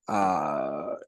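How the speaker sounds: ordinary talk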